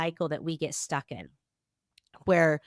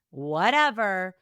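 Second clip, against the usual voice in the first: ordinary talk, nothing marked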